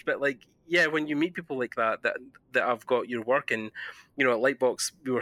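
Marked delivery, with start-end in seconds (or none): none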